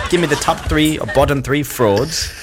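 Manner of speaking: mock old-time British accent. Shown as a demonstration. American host questions British guest, hammed-up UK accent. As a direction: put on an exaggerated Victorian-sounding UK accent. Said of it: "my best version of a U K accent".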